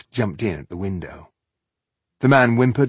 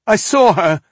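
high, loud, hurried fear; quick frightened; 12 semitones higher, 10 dB louder than usual. Acted. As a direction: blurt it out in fear. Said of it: "Franek said quickly".

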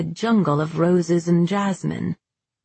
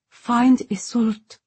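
ordinary delivery

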